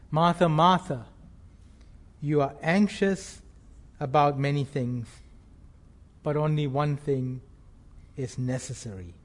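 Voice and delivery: soft, gentle rebuke; Jesus gently calming an anxious Martha; tender. Acted. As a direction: ease her worry kindly, voice dropping low.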